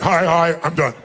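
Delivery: low, clipped hi and done; curt brush-off. Retold or imitated. Mocking a seminar attendee who greets and quits at once. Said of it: "you didn't go and you went".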